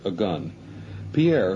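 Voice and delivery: usual speaking voice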